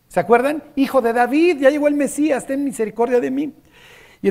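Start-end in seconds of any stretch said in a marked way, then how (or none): 0.8-3.5 pleading shout. Retold or imitated. Blind beggar crying out to the passing Messiah, high pleading shout. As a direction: shout a desperate plea for mercy. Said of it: "le grita".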